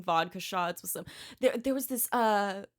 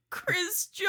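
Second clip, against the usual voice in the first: crying out a name; 10 semitones higher than usual. Retold or imitated. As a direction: wail the name through tears. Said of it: "And I went".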